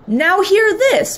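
high, slow, projected call; drawn-out attention-getting call; megaphone announcement. A narrator's reading. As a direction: announce it like a proud captain. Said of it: "announced on his mega megaphone".

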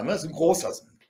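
ordinary talk

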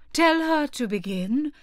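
slow, loud turtle voice; Mock Turtle voice. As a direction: urge it with weight.

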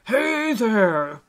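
friendly hail. Acted. Old elf finds Alistair under the tree, hailing him. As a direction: call out to someone hiding. Unknown voice hails hiding Alistair.

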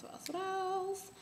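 playful high-pitched sing-song. Real, not acted. Musing aloud, then playful repeat.

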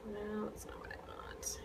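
whispered aside to herself; whispering. Real, not acted. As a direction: whisper it under your breath. Muttering to herself.

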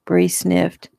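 ordinary delivery

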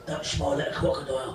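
ordinary talk

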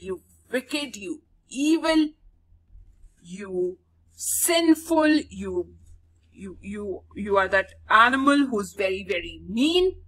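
a mildly acted furious mother cursing the mongoose; mock angry scream. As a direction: stammer with rage, then let it burst out. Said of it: "She screamed".

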